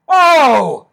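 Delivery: loud, high "oh" of joy; big overjoyed outburst; excited yell. Real, not acted. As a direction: holler it out in delight. Fan overjoyed at helmet news.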